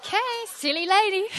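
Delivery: laughing, high and rueful; amused. Retold or imitated. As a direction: chuckle it out to yourself. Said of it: "I thought".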